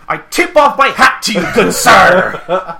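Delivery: loud, high hammed-up gallantry; over-the-top put-on voice, laughing at the end; Applejack quip. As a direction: proclaim a mock-courtly joke line. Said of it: "funny line for Applejack".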